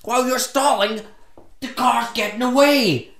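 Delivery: high, loud mimicked yell; imitating a woman shouting at him; retold shouting. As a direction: yell it like the panicked lady, high-pitched. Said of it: "she's shouting at me".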